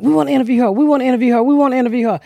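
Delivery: light mock of eager interviewers; mock eager reporters. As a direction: imitate reporters calling out over and over. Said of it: "they was like".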